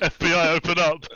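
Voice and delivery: loud FBI door bang shout; police shout. Pretend. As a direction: bellow it like a cop raiding.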